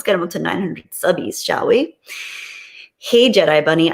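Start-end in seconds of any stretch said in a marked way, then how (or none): none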